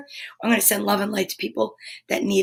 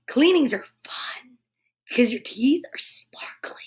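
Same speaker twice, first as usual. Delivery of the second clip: whispering; 5 dB quieter than usual. Real, not acted. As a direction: whisper it like a secret. Whispered aside about dentist.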